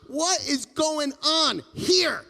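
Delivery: startled shout. Retold or imitated. People freaking out.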